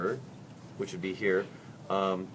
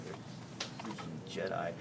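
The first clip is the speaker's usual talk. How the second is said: low aside; 3 semitones lower, 11 dB quieter, much slower than usual. Real, not acted. Joking about shark senses.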